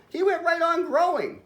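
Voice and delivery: high-pitched, amazed storytelling; amazed storyteller. A narrator's reading. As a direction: tell it with wide-eyed alarm. Astonished narrator, fish keeps growing.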